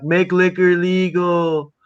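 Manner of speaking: hammed-up drunk declaring; slurred drunk demand. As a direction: drag the words out drunkenly. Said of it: "I'm like".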